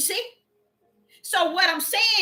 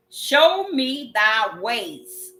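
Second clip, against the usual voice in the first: 非常缓慢地重读经文; 一字一顿，庄严地念出; very slow scripture reading; 3 dB louder, much slower than usual. Real, not acted. Preacher rereads verse very slowly.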